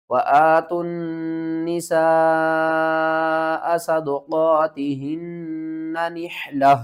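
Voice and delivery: high, loud, slow melodic tajweed chant; Quran recitation. Acted. Teacher reciting Surah An-Nisa for students, melodic and loud. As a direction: sing out the verse in full tarteel.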